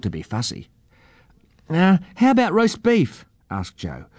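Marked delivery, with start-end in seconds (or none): 1.7-3.2 put-on high, hesitant child's offer; helpful young girl. A narrator's reading. Joe offers food to the eagle, high child's voice.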